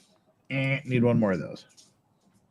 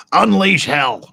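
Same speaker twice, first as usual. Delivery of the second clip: higher, louder battle shout; loud rally cry; 6 semitones higher, 9 dB louder, slower than usual. Real, not acted. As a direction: bellow it out, not the slow movie take. Repeats the line loudly.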